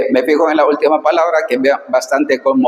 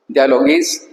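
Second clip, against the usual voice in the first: dialogis jumps up high at the end; sharp rising emphasis; 11 semitones higher than usual. Shown as a demonstration. Teacher calling attention to a tricky Greek word, rising. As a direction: lift dialogis up to flag it. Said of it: "atención".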